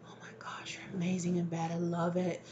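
breathless-with-awe whisper, acting a besotted lover; hushed, whispered swooning; smitten awed whisper. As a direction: whisper it like you're overwhelmed by adoration. Acting out the smitten masculine's reaction.

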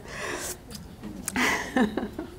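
airy, voiceless trailing chuckle; soft breathy laugh. Real, not acted. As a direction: let the laugh fade out. Trailing laugh after the story.

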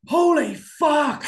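loud, slow, stunned yell; shocked shout. Retold or imitated. Seeing Rubiel on stage at the show, a loud stunned shout. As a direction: blurt it out loud in pure shock. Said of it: "I kinda shouted it loud".

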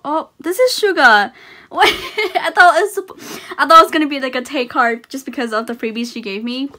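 thrilled gasping disbelief; surprised. Real, not acted. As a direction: blurt it out in disbelief. Surprise at [name] card.

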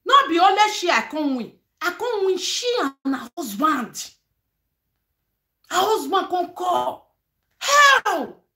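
rising to a delighted yell; excited triumph. Real, not acted. Vlogger telling viewers how she won, excitedly.

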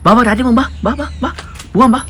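high, eager calling to a pet; excited coaxing. Real, not acted. Owner calling the dog out for a ride, excited coaxing. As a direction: urge the dog along in a high voice.

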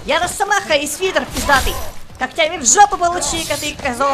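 very loud, high gloating shouts; triumphant yelling. Real, not acted. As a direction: roar it out as you land hits. Торжествующие крики в бою. (торжествующий крик)

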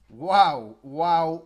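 loud, booming wow; big punchy exclamations; amazed wows. Real, not acted. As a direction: belt out each wow in amazement. Amazed at battle result.

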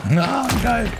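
high delighted exclaim; excited. Real, not acted. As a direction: cheer it out. Happy after best round.

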